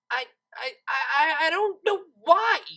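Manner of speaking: cornered and blurting it out; flustered outburst. A narrator's reading. Lyra cornered by the cellist, stammering outburst.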